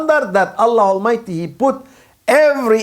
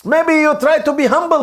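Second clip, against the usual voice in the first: normal talking voice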